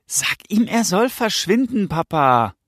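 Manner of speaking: put-on high, urgent plea in a boy's voice; agitated son. Son to his father about the stranger, urgent demand.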